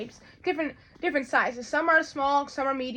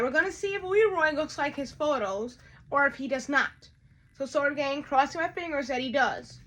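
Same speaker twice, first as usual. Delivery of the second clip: usual speaking voice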